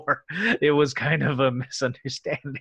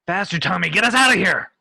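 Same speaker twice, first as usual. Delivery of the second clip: louder, higher at the end; shouted order; 5 semitones higher, 6 dB louder than usual. Acted. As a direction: yell at the pilot to flee. Captain yells at pilot.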